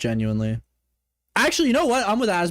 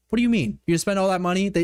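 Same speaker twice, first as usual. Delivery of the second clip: normal talking voice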